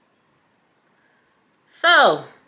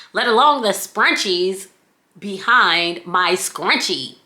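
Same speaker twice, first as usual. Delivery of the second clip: normal talking voice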